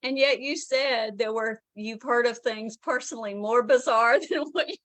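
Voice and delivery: giggling as you needle a friend; laughing tease. Real, not acted. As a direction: tease them, laughing through the words. Teasing author, everyone cracking up.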